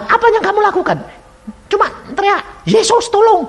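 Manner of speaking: excitable dialogue pitched far above normal; agitated acted exchange. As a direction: act out a flustered questioning, high and urgent. Acted rapid police and maid exchange.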